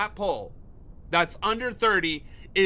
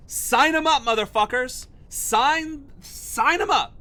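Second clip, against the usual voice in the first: higher and louder, barking out sign 'em up; furious sarcastic shout at the pollsters; mocking shout; 6 semitones higher, 5 dB louder than usual. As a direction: yell it like a sarcastic recruiter. Mock recruiter yelling at poll supporters.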